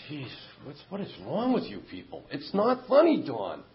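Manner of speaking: rising exasperated outburst at the sponsor. Retold or imitated. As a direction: blurt it out, fed up. Retold exasperation at laughing sponsor.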